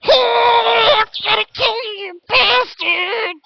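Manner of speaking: 1 berserk gnome Ricky. Acted. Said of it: "Ricky is just going".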